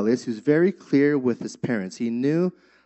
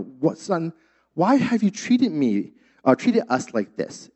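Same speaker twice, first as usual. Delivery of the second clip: pleading, higher voice as anxious Mary; Mary pleading with her lost son, voice rising; distressed mother; 5 semitones higher, slower than usual. Retold or imitated. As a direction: lift the pitch, a mother's hurt question. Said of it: "Mary said".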